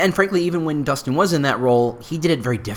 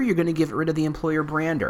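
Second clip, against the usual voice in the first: ordinary talk, nothing marked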